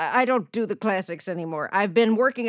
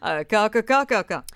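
crow cawing; faster than usual. Acted. Bird in-law cawing.

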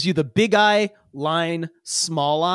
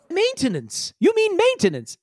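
much higher, puzzled repeat; a robot baffled by the word; puzzled bot; 13 semitones higher than usual. Acted. Chanti Kalo puzzles over the crew's hygiene request, high puzzled repeat.

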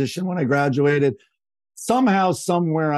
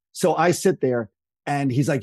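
normal talking voice

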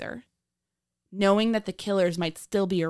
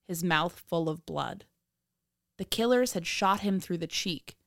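true crime narrator; 10 semitones higher than usual. A narrator's reading.

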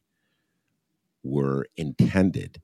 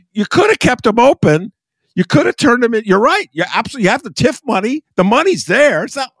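much higher and louder impassioned rant; real worked-up outburst, pitch way up; getting all excited; 8 dB louder than usual. Real, not acted. Guest loses his calm over closed schools, loud excited rant. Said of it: "I'm getting all excited".

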